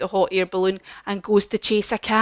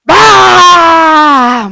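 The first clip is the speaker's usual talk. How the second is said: full-throated roar, much louder; lion roar; 8 semitones higher, 16 dB louder than usual. Pretend. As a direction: roar like a brave lion, all out. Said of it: "we need to be a bit louder this time".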